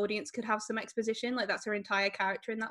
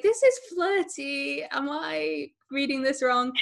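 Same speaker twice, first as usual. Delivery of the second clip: unsure; 5 semitones higher, slower than usual. Retold or imitated. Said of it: "But also I was like".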